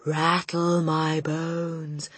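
very slow, drawn-out ghost voice; spooky. A narrator's reading. Hidden voice haunts the family, spooky drawl. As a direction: intone it eerily like a spook. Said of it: "soft and spooky this time".

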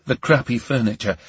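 ordinary delivery

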